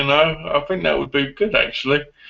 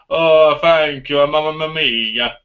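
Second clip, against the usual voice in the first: slow, hammed-up Italian accent, a little louder on the oh; a pizza lover's put-on Italian accent; mock Italian accent; 4 dB louder, much slower than usual. To viewers, showing how pizza makes him talk: slow, hammy Italian accent. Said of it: "it makes me talk like an Italian".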